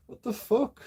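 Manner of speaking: high, incredulous exclamation; incredulous outburst. Real, not acted. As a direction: throw up your hands in disbelief. Exasperated outburst after laughing.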